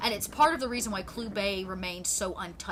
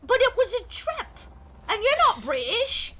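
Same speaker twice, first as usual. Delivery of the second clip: high-pitched Cockney protest; Walcott Savage Soul Byron; 12 semitones higher, 4 dB louder than usual. Acted. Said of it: "British, uh, Cockney voice".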